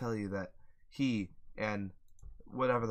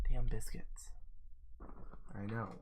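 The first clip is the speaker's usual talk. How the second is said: whisper; 5 dB quieter than usual. Real, not acted. Whispered complaint.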